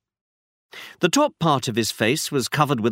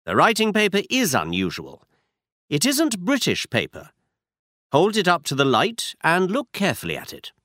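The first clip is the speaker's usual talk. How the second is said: a slightly higher, brisk Holmes; Holmes, lightly voiced; 7 semitones higher than usual. A narrator's reading. Holmes instructing Watson, lightly voiced detective.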